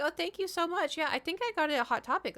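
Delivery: high, chirpy retold thanks; imitated polite gushing. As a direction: thank them in a high, quick voice. Acting out her own polite reply.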